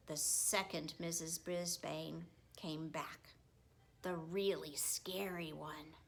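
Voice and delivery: hushed scary whisper; spooky whisper. A narrator's reading. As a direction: whisper it like a ghost story. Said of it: "whispered narration of scary teacher".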